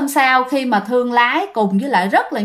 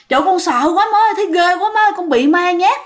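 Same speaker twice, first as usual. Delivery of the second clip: higher and fast, panicked wailing; terrified; 6 semitones higher, faster than usual. Acted. Daughter-in-law crying to her mother-in-law about the ghost, terrified. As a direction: blurt it out, scared out of her wits. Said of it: "cô con dâu rất là sợ hãi".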